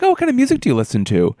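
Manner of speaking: a quick, high, friendly question; bright fast high question. Retold or imitated. Quoting own polite question.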